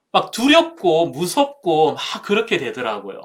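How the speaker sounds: usual speaking voice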